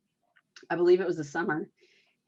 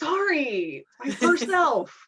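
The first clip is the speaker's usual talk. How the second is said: sheepish; 8 semitones higher, 5 dB louder, slower than usual. Retold or imitated. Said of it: "her reply to the elf".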